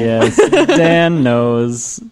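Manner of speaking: drawn-out, laughing "Dan knows"; laughing drawl. Real, not acted. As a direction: stretch "Dan" slowly through a laugh. Teasing about Dan.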